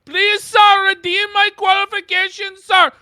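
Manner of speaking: high, loud pleading caricature voice; fluttering cries for help. Pretend. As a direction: beg in a high hammed-up voice.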